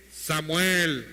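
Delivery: low, drawn-out call, at full voice; voice of God. Acted. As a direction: call the name out long and low. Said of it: "la voz de Dios que le dice".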